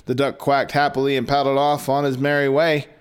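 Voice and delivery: ordinary delivery, nothing marked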